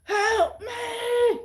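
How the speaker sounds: high, slow, wailing plea for help; pleading cat voice. Retold or imitated. Imagined cat in heat begging, high slow wail. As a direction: cry out like a suffering cat. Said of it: "I picture them just saying".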